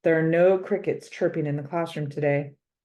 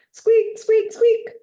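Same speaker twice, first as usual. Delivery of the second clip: much higher squeaky hamster squeaks; 7 dB louder, slower than usual. Acted. The hamster squeaks at the frog next door, much higher. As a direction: squeak like a frantic little hamster. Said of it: "has to get into the act".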